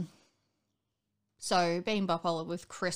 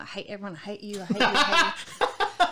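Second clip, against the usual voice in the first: laughing mock hatred; 2 semitones higher, 8 dB louder than usual. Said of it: "I'm like".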